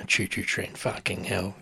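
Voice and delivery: ordinary talk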